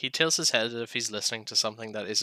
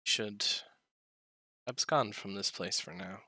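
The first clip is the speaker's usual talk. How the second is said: a hint of a solemn old dragon; lightly voiced dragon, measured and grave; dragon character voice. Dragon character Vershik speaks in-game.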